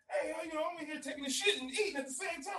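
high retold call from behind the bathroom door; high-pitched calling out. Stepfather calls out from the bathroom.

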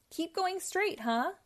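an understated narrated scream of blame; mock scream. A narrator's reading. Inky blames Pinky after the flood, mildly screaming. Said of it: "Inky screamed at Pinky".

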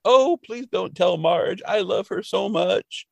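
high, drawn-out mock pleading; pleading drunk apology. Drunk Homer apologizing to Scully, high slow mock plea. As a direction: plead slowly in a high put-on voice. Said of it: "then he apologizes and is like".

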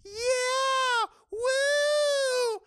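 put-on high squealy party girl voice; mocking party girls. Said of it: "and they're all like".

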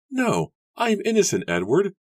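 high, forceful, urgent plea; fierce protest. A narrator's reading. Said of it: "Susanna whispered fiercely".

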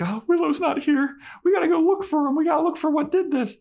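put-on very high, fast, urgent girl voice; high girly voice. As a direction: squeak it like a panicked girl.

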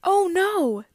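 high, loud dismayed gasp; dramatic storybook dismay. A narrator's reading. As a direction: alarm at the bad news.

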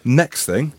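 ordinary delivery

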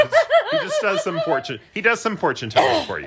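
giggling through the words; laughing. Real, not acted. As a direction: laugh while you say it. Laughing while describing blessing.

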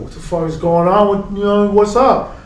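tipsy challenge. Retold or imitated. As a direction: come up on someone tipsy and confrontational. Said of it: "he's a little intoxicated".

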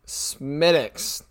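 Smittix said as two syllables, SMIT-iks, with no "th" or "w"; corrected pronunciation. Shown as a demonstration. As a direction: say "Smittix" the Irish way, silent w. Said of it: "I was corrected earlier".

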